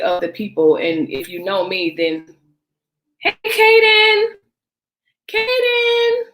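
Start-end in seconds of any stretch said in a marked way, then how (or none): 3.2-6.2 high drawn-out call across the house. Real, not acted. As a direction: call Kaden from another room. Calling to child off-mic.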